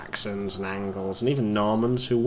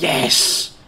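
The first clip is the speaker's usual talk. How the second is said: loud, exultant burst; triumphant cheer; 3 semitones higher, 7 dB louder than usual. Real, not acted. Player celebrating a lucky shot, triumphant.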